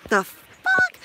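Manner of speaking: shocked. Real, not acted. Shocked by forty-five degrees.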